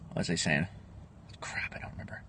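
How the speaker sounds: "Crap" breathed out as a whisper to himself; voiceless whispered self-talk; whispered aside. Real, not acted. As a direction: drop to a whisper, half to yourself. Forgets mid-stream, muttering to self.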